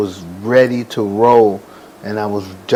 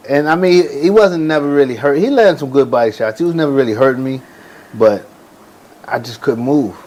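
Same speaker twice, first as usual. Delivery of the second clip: usual speaking voice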